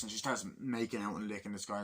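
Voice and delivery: ordinary talk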